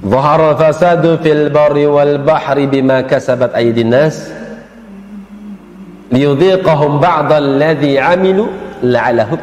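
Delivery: slow, melodic Quranic chanting; Quran recitation. Shown as a demonstration. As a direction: recite it slowly like tilawah. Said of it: "ayat yang dibaca". (bacaan tilawah yang lambat dan berlagu)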